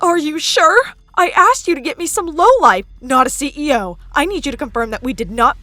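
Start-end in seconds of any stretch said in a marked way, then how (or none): 0.0-2.8 high, loud, indignant questioning; irate disbelief. Acted. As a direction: berate an accomplice who botched the job. Stepsister berates someone on the phone.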